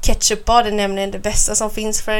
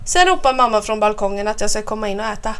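ordinary delivery, nothing marked

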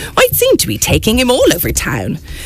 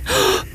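gasp; 11 semitones higher, 4 dB quieter than usual. Acted. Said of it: "Mouse let out a cry".

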